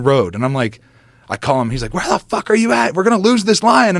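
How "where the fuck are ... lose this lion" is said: high, pushy mock shout; impatient yell. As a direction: hurry him, you're about to lose it. Alex impatient on the phone.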